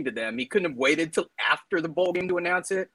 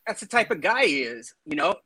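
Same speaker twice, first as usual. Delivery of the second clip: usual speaking voice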